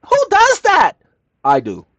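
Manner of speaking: high, loud cry of astonishment; mock disbelief. Boasting about filing both at the same time, mock-incredulous shout. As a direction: shout it in exaggerated amazement.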